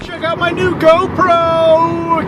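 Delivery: high, slow, hyped promo voice; mock excited. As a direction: hype it like an ad. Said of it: "Kidding, it's my phone".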